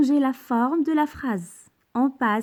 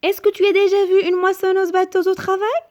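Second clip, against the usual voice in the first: high and louder, lifted question tune; question intonation; 7 semitones higher, 4 dB louder, faster than usual. Shown as a demonstration. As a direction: ask it clearly with a rising voice. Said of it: "il faut poser bien la question".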